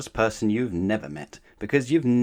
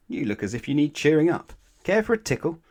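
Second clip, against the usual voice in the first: higher, louder, playful voice; Mister Tickle; 7 semitones higher, 5 dB louder than usual. Acted. Mister Tickle offering gloomy Mister Tall a tickle, playful and high. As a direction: tease him with a bouncy offer.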